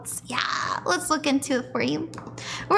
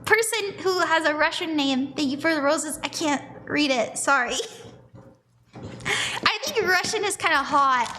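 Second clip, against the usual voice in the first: ordinary delivery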